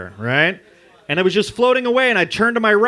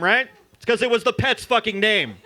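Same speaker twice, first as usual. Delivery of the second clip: ordinary talk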